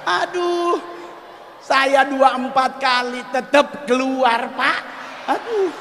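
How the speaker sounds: high, loud, wailing aduh, Tuhan; hammed-up panic. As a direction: ham up desperate wailing. Comic panic over koteka. (meratap panik, tinggi dan keras)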